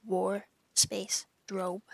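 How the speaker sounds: "war, space, drobe" in separate pieces; "war, space, drobe" spelled out slowly with gaps; wardrobe split apart. Shown as a demonstration. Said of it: "the word wardrobe like".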